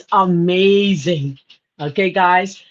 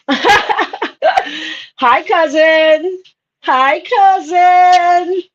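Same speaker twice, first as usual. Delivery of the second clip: high, louder, laughing hello; excited greeting; 8 semitones higher, 4 dB louder, much slower than usual. Real, not acted. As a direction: greet her with a big delighted cry. Greeting cousin in chat.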